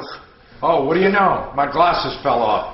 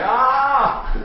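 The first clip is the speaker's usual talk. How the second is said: startled yelp; 11 semitones higher, 4 dB louder than usual. Retold or imitated. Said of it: "I was like".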